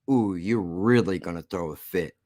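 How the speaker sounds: low, soft taunting purr; soft teasing. Real, not acted. Vlogger teasing angry viewers, hushed and low. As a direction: tease them under your breath.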